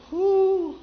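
a sharp, high whew of delight. Real, not acted. Preacher exclaiming to the congregation, high whew. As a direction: blow out a high whoop.

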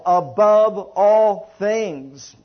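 preacher lingering on "Above all things"; slow emphatic preaching. Acted. Preacher pressing the verse home.

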